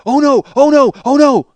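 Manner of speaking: high, loud cries of alarm; acted panic. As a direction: imitate a panicking kid calling out. Brother panicking at a fire.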